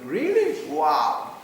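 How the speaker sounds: high, loud burst of astonishment; retold loud, leaping surprise; amazed. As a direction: react with huge, hammed-up amazement. Acting out amazement at the girlfriend.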